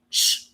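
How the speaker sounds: imitated cousin's short shush. Cousin silencing her as they spill tea, urgent shush. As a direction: hush a friend mid-gossip.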